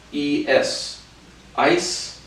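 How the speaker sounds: ordinary delivery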